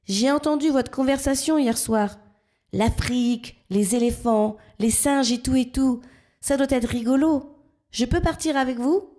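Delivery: a bubbly little frog asking to join; eager frog. A narrator's reading. Frog asks the two friends to take her along, eager.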